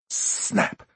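voiceless whispered snap. A narrator's reading. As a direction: breathe it out as a whisper. Killer's inner thrill at kill.

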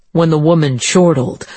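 ordinary delivery, nothing marked

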